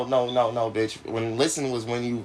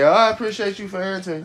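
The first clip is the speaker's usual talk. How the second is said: mocking a caller; 7 semitones higher, 5 dB louder than usual. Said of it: "and she can say".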